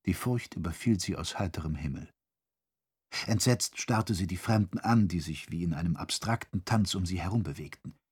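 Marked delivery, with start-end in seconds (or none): none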